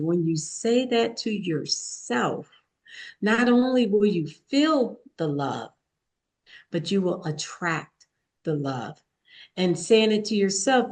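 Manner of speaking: ordinary talk, nothing marked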